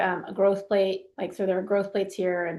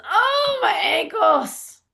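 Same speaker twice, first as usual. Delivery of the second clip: high, slow, hammed-up wail over her ankles; crumbled on the floor, a gymnast wails in mock pain; mock moaning; 7 semitones higher, 4 dB louder, much slower than usual. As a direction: ham up a gymnast crying about her ankles. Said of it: "crumbles to the floor and goes".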